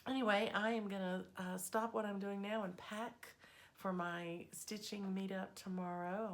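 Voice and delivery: ordinary delivery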